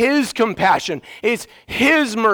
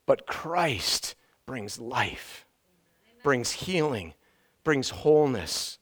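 slow, much softer; hushed hope; 8 semitones lower, 10 dB quieter, slower than usual. Acted. Preacher turning to Christ's promise, hushed and slow. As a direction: breathe it out gently, slowly. Contrast after death.

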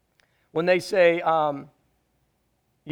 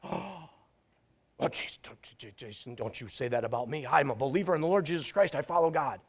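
hushed, near-whispered wounded protest, much softer; mock hurt protest; 6 semitones lower, 8 dB quieter, faster than usual. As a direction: play the offended believer in a hushed gasp. Offended churchgoer mocked by preacher.

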